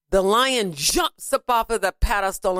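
ordinary delivery

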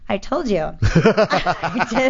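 words breaking up into laughter; amused, laugh spilling over the line. Real, not acted. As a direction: say it through a real laugh. Laughing as she retells her reply.

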